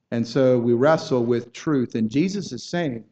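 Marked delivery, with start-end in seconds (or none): none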